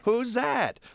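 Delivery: put-on very high boyish call; very high child calling a name; young boy voice. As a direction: pipe it up like a little boy. Child voice in the story.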